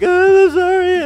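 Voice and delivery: long, high, loud wail; hammed-up scream, drawn right out; mock screaming apology. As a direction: imitate a panicked kid shrieking while fleeing. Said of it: "I start screaming and running".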